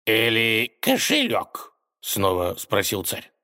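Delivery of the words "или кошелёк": slow, teasing counter-question; mocking. A narrator's reading. Tsar asking the robber once more, teasing. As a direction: dangle the other option slowly. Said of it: "с издёвкой уточнил".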